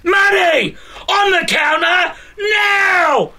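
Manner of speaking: bank robber. Acted. Robber yelling at the clerk.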